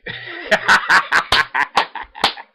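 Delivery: real cackling burst of laughter; long hearty laugh. Real, not acted. Recapper amused by Ogami trolling her, laughing hard. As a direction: crack up loudly and keep going. Cracks up at own imitation.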